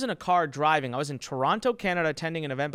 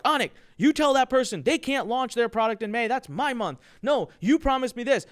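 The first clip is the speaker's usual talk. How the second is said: put-on higher, louder childish complaining; mock bickering affiliate, higher and louder; squabbling affiliate; 6 semitones higher, 4 dB louder than usual. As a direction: whine like a kid demanding his month.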